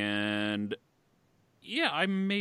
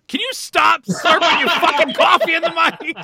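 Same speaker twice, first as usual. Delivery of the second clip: loud joking yell, much higher; mock outraged shout; 15 dB louder than usual. Mock-outraged at a coffee slurp.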